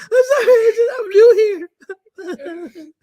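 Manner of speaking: high, squeaky frightened pleading; panicked apology. Retold or imitated. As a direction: plead like a scared new clerk. Teen clerk pleads while yelled at.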